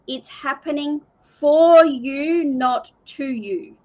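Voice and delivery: slow, with "for" punched loud and "to" stretched; deliberate, weighty stress on "for" and "to"; emphasized for and to. Real, not acted. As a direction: hammer "for you", then set "not to you" slowly against it. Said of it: "I want to emphasize the words".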